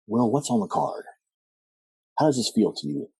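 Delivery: lightly voiced psychologist; a hint of the counselor. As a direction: gently quiz a patient, imitating a therapist. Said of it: "like, psychologist voice".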